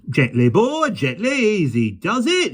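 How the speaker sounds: high, slow soothing coax; calm the unicorn gently; gentle coaxing. A narrator's reading.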